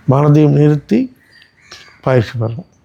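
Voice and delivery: ordinary talk, nothing marked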